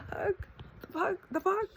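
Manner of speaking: high-pitched, baffled disbelief; stunned, high-voiced questioning; incredulous. Real, not acted. Incredulous at own past fears.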